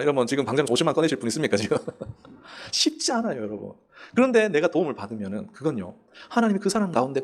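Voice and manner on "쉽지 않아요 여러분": laughing. Real, not acted. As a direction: chuckle it out gently. Laughing at the congregation. (웃으면서 천천히 하는 말)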